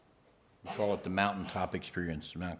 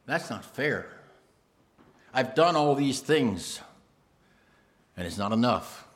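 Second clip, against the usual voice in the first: mild complaint; 4 semitones higher, 5 dB louder than usual. Acted. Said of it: "mumble under his breath".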